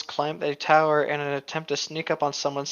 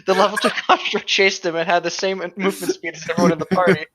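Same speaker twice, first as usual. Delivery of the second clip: laughing while telling; 3 semitones higher, 3 dB louder than usual. Real, not acted. Laughing through the story.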